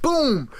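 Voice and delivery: hype boom. Acted. Host hyping his next pick, loud boom. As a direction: slam out a single boom.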